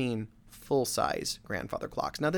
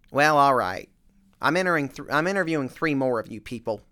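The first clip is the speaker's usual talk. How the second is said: weary grudging reply; 4 semitones higher, 8 dB louder than usual. Retold or imitated. Tired homeowner grudgingly agrees.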